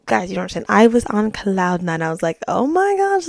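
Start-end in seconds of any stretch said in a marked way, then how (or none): 2.5-3.3 high, drawn-out mock swoon; elated. Host reenacting being on cloud nine, elated. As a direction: reenact giddy delight, pitched way up.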